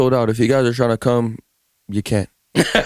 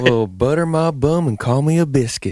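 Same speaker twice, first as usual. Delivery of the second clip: Southern accent impression; 3 semitones higher than usual. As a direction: imitate a Southern belle's folksy twang. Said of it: "that's more of something I would probably say".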